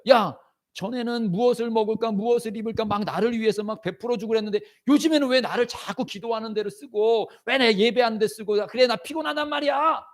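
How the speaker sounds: high-pitched, whining protest; resisting complaint. Acted. The flesh protesting being used for prayer, complaining. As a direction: grumble upward into a worn-out objection. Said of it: "막 육신은 막 저항을 해요".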